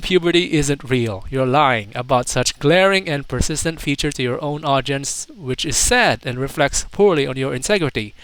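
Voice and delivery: ordinary delivery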